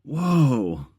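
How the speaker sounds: loud, high, drawn-out mock whoa; amazed reaction. Student watching a live audio edit, imitated amazed whoa. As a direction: imitate an awestruck student's outburst. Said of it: "I literally saw somebody go".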